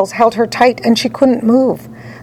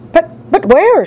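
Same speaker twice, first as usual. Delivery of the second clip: high, stammering alarm; shriek; 8 semitones higher, 4 dB louder than usual. A narrator's reading. Frantic mother questions the factory owner, high stammered shriek. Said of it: "shrieked Missus Salt".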